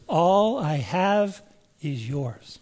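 "All I have is yours" slow and soft, leaning on "All"; slow, soft emphasis. Shown as a demonstration. Preacher urging the congregation to key on the words, slowly and softly. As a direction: draw every word out gently. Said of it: "Key on that word, those words".

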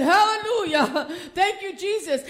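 high, loud laughing hallelujah; joyful praise. Retold or imitated. Grandmother rejoicing at her granddaughter's news, laughing loud.

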